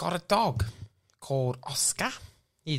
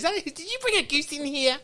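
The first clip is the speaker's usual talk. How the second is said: surprised call; 10 semitones higher, 4 dB louder than usual. Acted. Startled by a goose noise.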